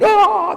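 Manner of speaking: very high delighted "oh"; thrilled fan squeal. Retold or imitated. Fans hearing the catchphrase, high thrilled squeal.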